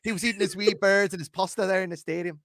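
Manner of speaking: put-on lightly done Irish accent, a bit high; a jokey, half-hearted Irish brogue; a deliberately poor Irish accent; bad Irish accent. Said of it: "Give us the Irish accent".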